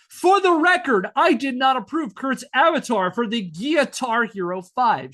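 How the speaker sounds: mock outrage in a widow's voice; indignant declaration. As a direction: ham up an outraged public denial. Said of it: "later declaring to a reporter".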